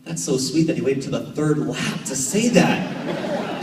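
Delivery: slow, amused sarcastic aside with a laugh; wry sarcasm. Retold or imitated. Dad mocking his son's late verdict, slow wry laugh. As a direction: drag it out, laughing at the kid's timing. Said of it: "But I also was like".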